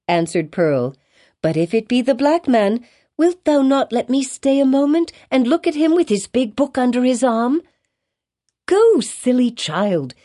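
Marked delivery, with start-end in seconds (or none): 1.4-7.6 put-on high, curious little girl's voice; pleading child. A narrator's reading. Child asking her mother to stay and look, pleading. 8.7-10.1 high, drawn-out impatient command. A narrator's reading. Mother sending her silly child away, impatient. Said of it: "said her mother impatiently".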